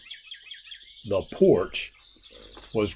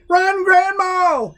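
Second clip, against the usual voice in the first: high, slow, loud warning yell; 5 dB louder, much slower than usual. A narrator's reading. Jack yelling to Grandma as the monster comes, high and loud. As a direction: shout the warning long and high. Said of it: "yelled Jack".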